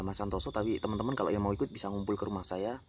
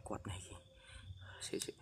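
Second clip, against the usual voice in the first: whispering; 11 dB quieter than usual. Real, not acted. Ghost hunt, whispered aside.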